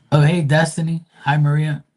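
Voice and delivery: high, loud, happy hello; light up as they arrive; excited greeting. Real, not acted. Greeting viewers joining the stream.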